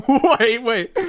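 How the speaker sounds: high, laughing burst; laughing disbelief. Real, not acted. Streamer thrown by the game, laughing disbelief.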